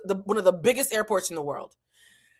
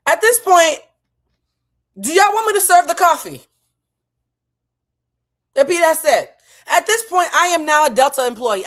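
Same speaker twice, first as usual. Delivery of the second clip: loud, higher outraged outburst; exasperated rant; 9 semitones higher, 9 dB louder than usual. Real, not acted. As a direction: rant at the absurdity.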